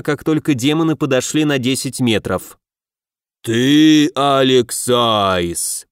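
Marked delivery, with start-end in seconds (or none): 3.4-5.9 roaring demon. A narrator's reading. Left demon confronting Alex, a slow roar. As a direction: bellow it like a huge demon. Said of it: "проревел левый".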